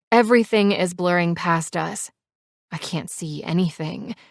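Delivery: plain reading voice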